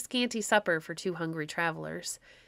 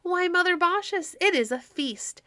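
much higher, louder delighted exclaiming; delighted exclamation; 10 semitones higher, 4 dB louder than usual. Acted. Quicksilver delights in the feast, exclaiming much higher and louder. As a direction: burst out with boyish delight. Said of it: "exclaimed Quicksilver, laughing".

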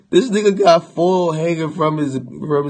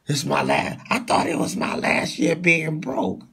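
ordinary talk, nothing marked

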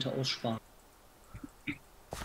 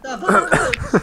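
hoch und langsam lachend nachgeäfft; lachend nachäffen; 10 dB louder, much slower than usual. Real, not acted. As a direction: den Satz lachend und gedehnt nachäffen. Spottet den Satz des Mitspielers nach. (lachend nachgeäfft)

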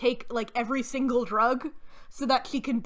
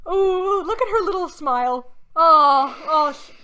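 higher, louder laughing coo; real delighted laughter; 4 semitones higher, 6 dB louder than usual. Real, not acted. Laughing at the actress's smile with a friend, cooing.

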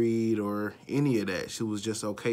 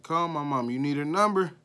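put-on loud, higher-pitched cocky kid dare; brash, raised-voice bravado; mimicking defiant kids; 6 semitones higher, 6 dB louder than usual. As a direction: throw it out like a kid who couldn't care less.

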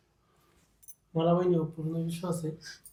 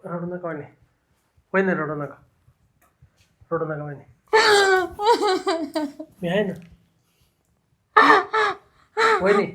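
comforting; 8 semitones higher than usual. Acted. Consoling crying woman.

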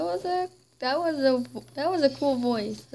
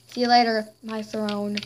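usual speaking voice

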